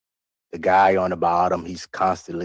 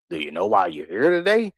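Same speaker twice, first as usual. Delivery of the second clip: higher, talking down to him; condescending mimicry; 10 semitones higher than usual. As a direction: mimic a patronising official. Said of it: "That's condescending".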